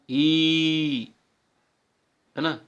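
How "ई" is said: ई drawn out as one pure vowel; vowel ई demo. Shown as a demonstration. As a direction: sound out ई for the class. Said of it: "अब बोल के देखो". (ई का लंबा शुद्ध उच्चारण)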